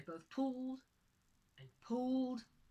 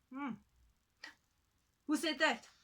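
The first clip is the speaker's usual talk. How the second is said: higher, louder, startled woman; a frightened woman asking into the air; startled question; 6 semitones higher, 9 dB louder than usual. Acted. Woman hearing a hidden voice, startled and louder. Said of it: "she said".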